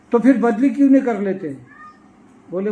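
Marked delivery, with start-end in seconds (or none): 0.1-1.6 loud acted comeback; sharp retort. SDO demanding why he never transferred, loud retort. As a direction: throw the question back forcefully, as the officer.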